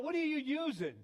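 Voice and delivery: high-pitched mock holler; retold yell. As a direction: imitate calling across to another boat. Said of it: "This guy yells out".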